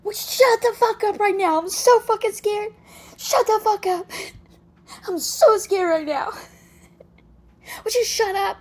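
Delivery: high, loud, panicky mock yelling; Will Ferrell impression. Mocking the boyfriend's rant, high hammed-up panicked yelling. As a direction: scream it like a comic who is yelling and scared at once. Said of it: "It reminds me of the Will Ferrell".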